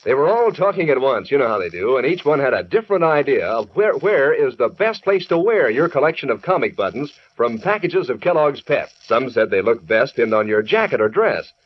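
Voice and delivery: ordinary talk, nothing marked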